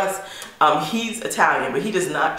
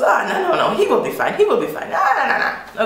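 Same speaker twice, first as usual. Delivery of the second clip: breezy dismissal; 8 semitones higher than usual. Retold or imitated. Paolo breezily dismisses the worry.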